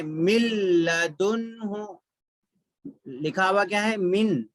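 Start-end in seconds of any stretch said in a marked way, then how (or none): 0.0-1.9 मिल्लदुन्हु slowly, n of min merged into a long ल; merged lam reading. Shown as a demonstration. Teacher showing how nun before lam is read, slow merged recitation. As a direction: hold the doubled ल in मिल्लदुन्हु. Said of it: "कैसे पढ़ेंगे?".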